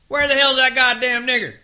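high, far-off calling voice; distant caller. A narrator's reading. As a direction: holler from the yard.

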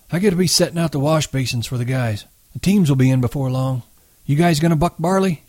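a hint of an eager old man; old swamper. A narrator's reading.

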